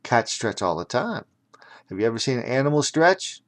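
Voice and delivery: ordinary delivery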